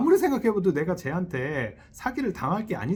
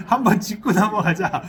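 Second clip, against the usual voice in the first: "한번 짚고 넘어가자" repeated with a laugh, louder; laughing mock demand; 3 semitones higher, 7 dB louder than usual. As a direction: playfully re-enact the challenge while laughing. Acted quarrel, repeated laughing. (웃으면서 장난스럽게 "한번 짚고 넘어가자")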